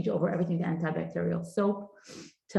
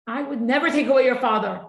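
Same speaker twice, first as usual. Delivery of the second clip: emphatic promise; 5 semitones higher, 6 dB louder than usual. Real, not acted. Comforting orphaned children.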